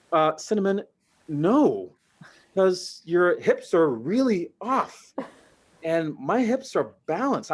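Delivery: slow, deliberate doctor's refusal; slow explanation. Retold or imitated. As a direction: spell it out patiently. Turning down the dancer.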